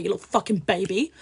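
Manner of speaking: ordinary talk, nothing marked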